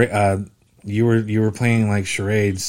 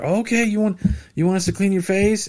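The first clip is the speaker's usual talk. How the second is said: surprised; 10 semitones higher than usual. Retold or imitated. Staff realizing what he wants.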